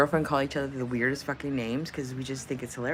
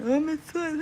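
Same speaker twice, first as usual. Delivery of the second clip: higher mock fan gushing; a light imitation of a gushing fan; imitating fans; 10 semitones higher than usual. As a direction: gush it like an eager stranger. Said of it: "they do it like this".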